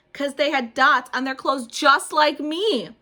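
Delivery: loud, slow wonder; amazed. A narrator's reading. Junie amazed at her classmates' dots, loud and slow. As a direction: marvel at the dots.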